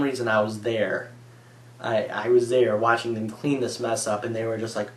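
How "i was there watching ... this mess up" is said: normal talking voice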